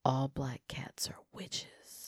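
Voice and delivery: slow hushed whisper; spooky whisper. Pretend. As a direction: breathe it out slowly like a secret. Spooky whisper about witch cats.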